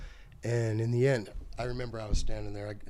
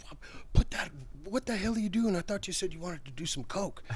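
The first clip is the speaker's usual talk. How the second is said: high, startled whisper; panicked under-breath scolding; alarmed hushed shout; 7 semitones higher than usual. Retold or imitated. [name] alarmed, urging.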